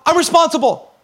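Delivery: high, emphatic announcement; mock-triumphant declaration. Preacher mimics turning eighteen, mock-triumphant. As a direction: proclaim it with put-on excitement. Mock-celebrates entering adult responsibility.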